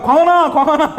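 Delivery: high, urgent retold call. Father calling his fleeing son, acted shout. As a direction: call after a child running off.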